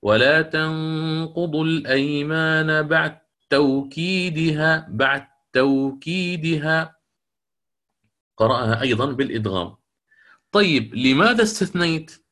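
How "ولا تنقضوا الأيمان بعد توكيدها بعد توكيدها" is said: slow recitation, بعد توكيدها as batteukidiha; with assimilation. Shown as a demonstration. Teacher recites al-Susi's reading of an-Nahl verse slowly. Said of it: "كيف قرأ هنا".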